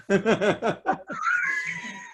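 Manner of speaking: big shrieking laugh. Real, not acted. Realising he got his age wrong, a shrieking howl of laughter.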